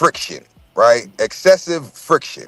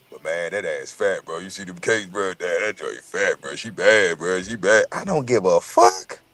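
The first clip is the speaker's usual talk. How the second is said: much lower, softer, lingering admiration; smitten guy; 10 semitones lower, 5 dB quieter than usual. Retold or imitated. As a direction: drool over her, slow and low. Voicing a smitten guy admiring a woman.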